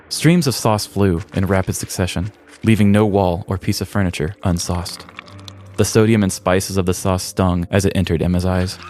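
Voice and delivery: usual speaking voice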